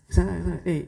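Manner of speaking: ordinary talk, nothing marked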